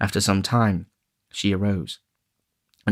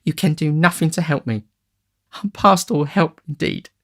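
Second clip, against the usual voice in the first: tearful despair; 9 semitones higher, 4 dB louder than usual. A narrator's reading. Nancy to the gentleman, tearful despair. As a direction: cry out that she is beyond help.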